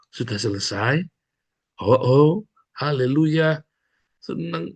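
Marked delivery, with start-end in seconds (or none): none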